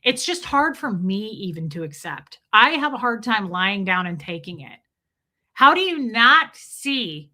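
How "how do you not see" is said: slow, louder, disbelieving demand; exasperated outburst. Real, not acted. Venting to viewers about staffers, exasperated and louder. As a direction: drag it out in frustrated disbelief.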